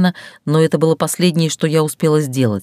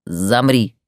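stern command; 5 semitones lower than usual. Acted. Captor commands her.

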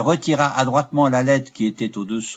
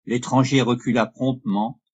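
plain reading voice

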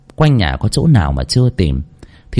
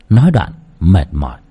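usual speaking voice

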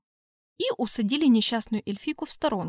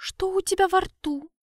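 high elf voice; 7 semitones higher than usual. Acted. Elf asks suspiciously.

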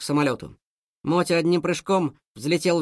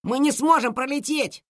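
frightened; 9 semitones higher than usual. Acted. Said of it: "испугался Ворчун".

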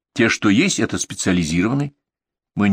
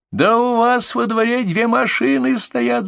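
high, slow screaming woman; shrieking; slower than usual. A narrator's reading. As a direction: scream it down the phone. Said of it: "заходился в крике женский голос".

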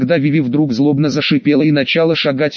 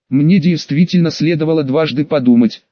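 ordinary delivery

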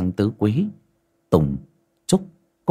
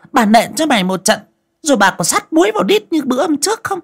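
much higher, loud shrill threats; furious shrew; 5 dB louder than usual. Acted. Mistress threatens to beat the maid, furious much higher. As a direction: threaten her with a beating.

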